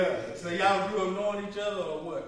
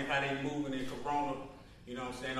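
normal talking voice

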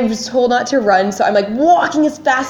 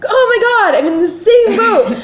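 high, louder exclamation of dismay; mock panic; 11 semitones higher, 4 dB louder than usual. Traveler retelling hearing of a four-hour delay, panicked. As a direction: wail it in exasperated disbelief.

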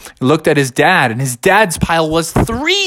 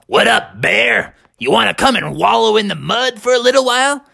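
pig voice; 6 semitones higher than usual. Acted. Pigs voiced in story.

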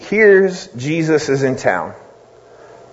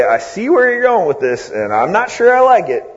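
wary; 6 semitones higher than usual. Retold or imitated. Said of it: "as if to say".

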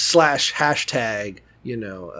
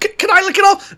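high eager homeless man. Retold or imitated. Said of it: "homeless guy".

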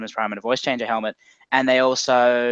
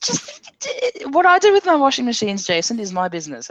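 much higher, louder huffy protest; mock indignation; 10 semitones higher, 4 dB louder than usual. Guest teasing the host back about his washing machines with mock offence. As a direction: play offended for the joke.

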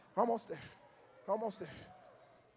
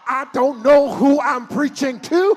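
louder and louder, shouted build; preaching at full force; 5 dB louder than usual. Acted. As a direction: whip the church into a shout.

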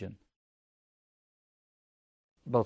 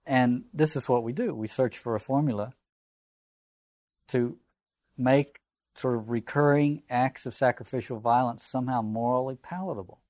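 normal talking voice

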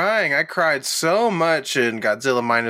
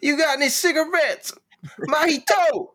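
raised-pitch movie quote; high character voice; 10 semitones higher than usual. Retold or imitated. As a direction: call out like the old lady.

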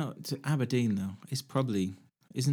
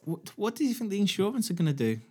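incredulous; 11 semitones higher than usual. Real, not acted. Incredulous question, high pitch.

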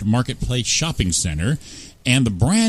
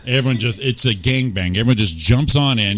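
normal talking voice

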